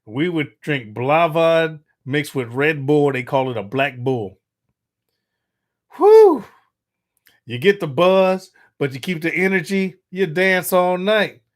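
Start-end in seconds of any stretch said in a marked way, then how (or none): 6.0-6.4 loud, high whistle of a whew; huge rising whew. Real, not acted. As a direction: blow out a big whew at the memory. Reacting to the drink.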